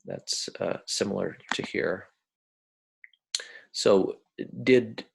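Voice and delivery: ordinary delivery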